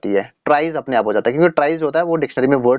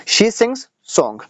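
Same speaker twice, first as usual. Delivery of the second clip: very slow, word by word, as if dictating; slow dictation; 6 semitones higher, much slower than usual. Shown as a demonstration. Teacher dictating to students, very slowly. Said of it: "she sings a song".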